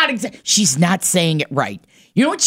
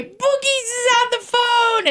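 mock call-out. Imitating T J announcing daughter's call, high sing-out.

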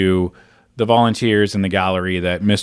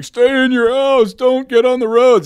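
high, loud mock storm-report yell; storm newscaster; 4 dB louder than usual. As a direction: shout it like a reporter getting blown away. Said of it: "newscasters wearing these, like, heavy-duty rain jackets".

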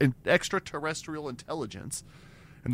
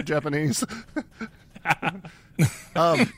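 usual speaking voice

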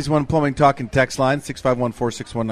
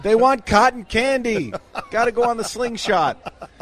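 harried fair-goer; 7 semitones higher than usual. Pretend. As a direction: play a frantic parent shoving through the crowd. Acts out pushy stroller parent.

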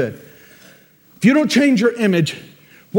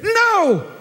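emphatic no; 12 semitones higher than usual. Acted. Angel rebuking Jacob.

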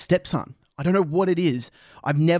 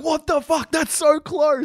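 much higher, stunned outburst; shocked disbelief; 10 semitones higher, slower than usual. Retold or imitated. Imitating a tennis fan reacting to a close line call. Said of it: "you're like".

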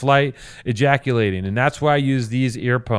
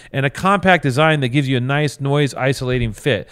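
ordinary talk

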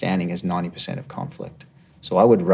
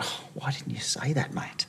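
soft whispered aside, much softer; retold whisper; 6 semitones higher, 10 dB quieter than usual. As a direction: hush it like a gentle reassurance. Retold soothing reply to suspect.

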